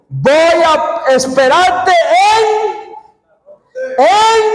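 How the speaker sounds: the Lord calling Moses to Horeb. Retold or imitated. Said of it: "el Señor le dijo a Moisés".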